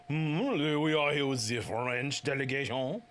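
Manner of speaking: put-on French accent. Player announcing the French delegation, French accent. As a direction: speak in a hammed-up French accent. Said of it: "French accent".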